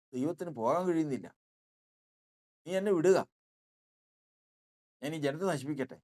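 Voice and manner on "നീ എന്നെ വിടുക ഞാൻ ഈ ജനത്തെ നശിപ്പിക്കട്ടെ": urgent demand. Acted. God pleads with Moses to release.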